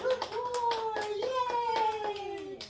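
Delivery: high, loud, slow gushing praise; shower the dog with delight; excited praise. Real, not acted. Praising dog after a success.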